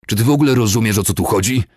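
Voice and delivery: loud, high angry outburst; bellowing. A narrator's reading. Commander rounds on his stunned subordinate, bellowing. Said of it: "huknął do oszołomionego czochora".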